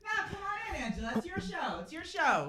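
very high, soft beckoning; mock coaxing. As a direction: coax her in as if tiny and timid. Mock coaxing co-host onto the show.

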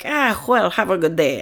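lightly voiced rabbi bowing out; a hint of a polite rabbi leaving.

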